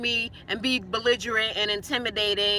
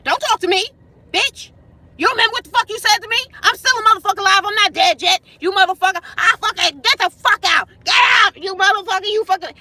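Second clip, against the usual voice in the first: much higher, louder furious yelling; a man yelling curses at his roommate, furious shout; imitated screaming rant; 8 semitones higher, 8 dB louder, faster than usual. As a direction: scream it like he's losing it.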